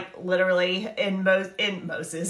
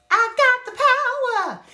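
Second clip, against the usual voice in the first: high, loud, proclaimed; preaching shout; 14 semitones higher, 4 dB louder than usual. Real, not acted. Preacher telling the congregation they have the power, loud and high. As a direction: belt it out like a rally cry.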